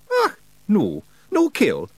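high, broad Scots wee man, cheeky and gruff; Scottish pictsie Rob. Acted. Pictsie chief thanking nobody for thanks, in a high Scots voice.

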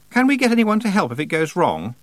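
high-pitched worried question; anxious girl. A narrator's reading. Frightened Anagramma asks for help.

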